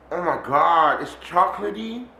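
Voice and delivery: ordinary delivery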